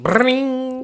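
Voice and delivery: high loud ringing alarm sound; shrill mock alarm ring; alarm ring imitation. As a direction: mimic the phone alarm going off, high and loud.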